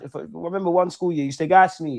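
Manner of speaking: ordinary talk, nothing marked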